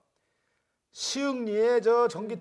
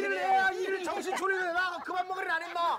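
put-on blackout drunk; 5 semitones higher than usual. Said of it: "술 취한 연기를 잘 하시네요".

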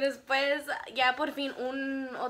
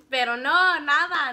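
louder, stressed no, nothing; emphatic letdown; 4 semitones higher, 11 dB louder, slower than usual. Real, not acted. Telling how he never spoke to her, emphatic letdown. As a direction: land it hard with disappointment.

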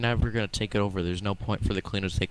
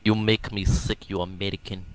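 put-on Russian accent. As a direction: drag it out in a fake Russian growl. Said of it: "you're using your Russian accent".

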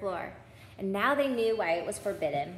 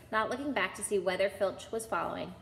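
ordinary delivery

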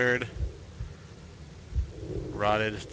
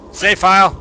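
loud, high burst of relief; thrilled to find a save; excited; 8 semitones higher, 12 dB louder than usual. Real, not acted. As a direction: shout it out with glee. Excited at finding save point.

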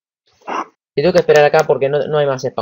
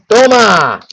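loud, higher burst of triumph; triumphant shout; 8 semitones higher, 8 dB louder than usual. Real, not acted. Gamer yelling at the dragon as the hit lands, triumphant.

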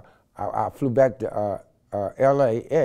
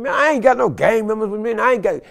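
retold shouted reply; 11 semitones higher, 9 dB louder than usual. Shouting back at SWAT.

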